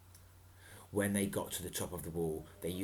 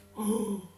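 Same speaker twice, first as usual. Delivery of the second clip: gasp; 12 semitones higher than usual. Acted. Reader gasps at dinosaur.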